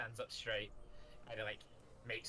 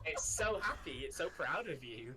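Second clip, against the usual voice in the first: gushing; 5 semitones higher, 4 dB louder than usual. Acted. GM gushing about creature.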